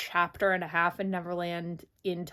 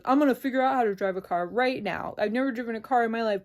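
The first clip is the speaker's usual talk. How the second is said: mock bravado; 5 dB louder, faster than usual. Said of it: "And Peter's like".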